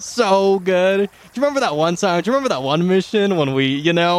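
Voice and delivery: high, quick, giddy gnome; excited reminiscing. Acted. Rock gnome recalls an old mission with a buddy, excited.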